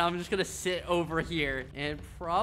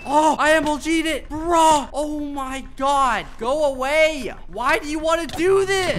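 high, loud frantic yells; frantic shouting; 7 semitones higher, 7 dB louder than usual. Real, not acted. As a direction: shout in the heat of battle. Fighting off an attacker.

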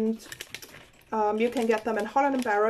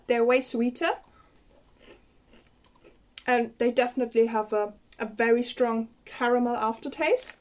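speaking between bites; talking while chewing. Real, not acted. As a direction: mouth partly full of candy. Seen 0: Puts a piece in her mouth at 0.6 and bites again at 11.8; closed-mouth chewing between.

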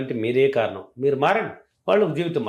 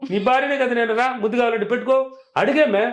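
playful coaxing wife; 7 semitones higher than usual. Retold or imitated. Wife teasing husband to come close.

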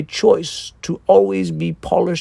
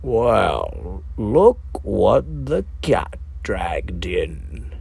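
lower, slower, a sly hint of a smile; Mort, amused surprise; 7 semitones lower, 3 dB quieter, slower than usual. A narrator's reading. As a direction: greet the corpses like old friends dropping by. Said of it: "with a glint of a hint of a smile and surprise".

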